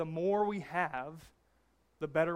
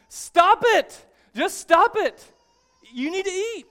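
much louder and higher, hammed-up yell; screaming counselor; 10 semitones higher, 11 dB louder than usual. Counselor yelling at the woman who won't eat. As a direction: blast it at her like a drill sergeant. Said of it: "And he just screams".

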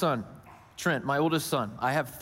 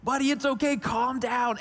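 higher, urgent parent voice; strained reassurance; 6 semitones higher than usual. Retold or imitated. Dad giving advice to his upset son, strained. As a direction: insist on calm, pitched up. Retold parent urging crying child.

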